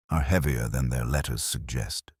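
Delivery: ordinary talk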